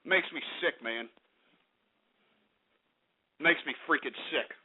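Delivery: low, spent grumble; soft and sickened, energy gone; quiet disgust. Real, not acted. Host sickened by prank callers, quiet disgust.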